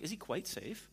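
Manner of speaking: lightly imitated British accent; a touch of British English, rising at the end. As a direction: softly mimic a polite British question. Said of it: "I love how British people talk".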